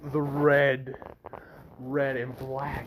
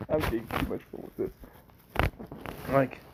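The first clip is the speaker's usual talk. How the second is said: ordinary delivery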